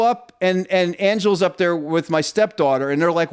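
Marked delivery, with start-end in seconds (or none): none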